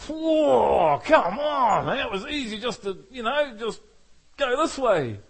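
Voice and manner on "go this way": normal talking voice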